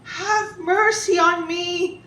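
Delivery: high, slow, drawn-out plea; begging. Acted. Carpenter to emperor, begging for mercy in a high drawn-out voice. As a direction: beg for mercy, stretching the words high. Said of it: "Ali begged".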